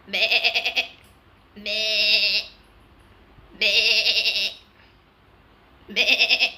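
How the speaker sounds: loud bleating goat imitation. Imitating a goat for the viewers, loud bleat. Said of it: "a goat".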